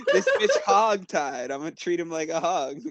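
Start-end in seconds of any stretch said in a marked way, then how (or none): 0.1-1.1 louder, slow, through laughter; laughing. Real, not acted. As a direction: laugh the words out. Laughing at the prisoner.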